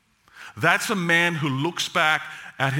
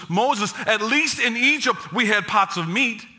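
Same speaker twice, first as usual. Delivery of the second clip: mock whiny grumbling, higher and fast; complaining Israelites; 6 semitones higher than usual. Preacher mimics Israelites griping about missing meat, higher and quicker. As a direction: play the people griping about food. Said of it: "they said to Moses".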